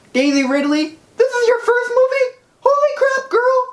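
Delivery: high, excited squeal of praise; excited gushing. Real, not acted. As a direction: gush like a starstruck fan. Excited fan gushing at actress.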